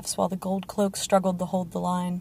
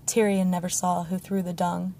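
plain reading voice

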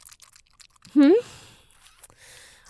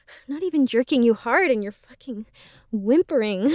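normal talking voice

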